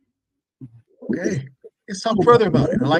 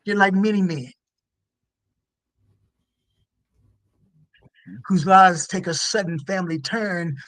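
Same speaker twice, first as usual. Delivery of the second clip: hushed raw poetry; 6 semitones higher than usual. Acted. Reads her poem aloud.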